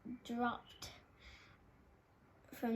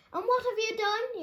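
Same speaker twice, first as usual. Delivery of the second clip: high, loud furious yell; angry yell; 11 semitones higher, 7 dB louder than usual. A narrator's reading. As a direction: demand an answer furiously. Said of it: "he yelled at the squirrels".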